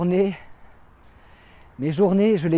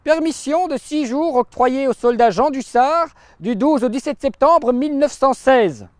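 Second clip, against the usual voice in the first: high, raised-voice official proclamation; reading aloud; 8 semitones higher, 4 dB louder than usual. Acted. Brigade's intellectual reading out a soldier's leave, proclaiming. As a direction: read the leave order out to the whole brigade. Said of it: "lut à haute voix".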